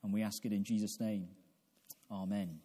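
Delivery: ordinary talk, nothing marked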